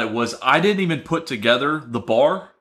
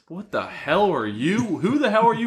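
rising outraged disbelief, higher; mock-furious incredulous rant; hammed-up Seth; indignant Seth impression; 9 semitones higher than usual. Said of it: "he says".

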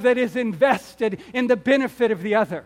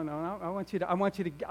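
normal talking voice

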